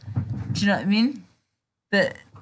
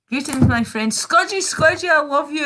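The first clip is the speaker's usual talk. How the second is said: high, loud gushing hello; excited greeting; 8 semitones higher, 4 dB louder than usual. Real, not acted. Gushing over her favourite chatter, loud excited greeting.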